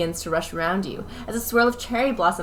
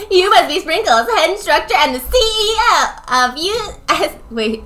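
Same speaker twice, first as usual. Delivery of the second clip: put-on excited girl voice; 9 semitones higher, 8 dB louder than usual.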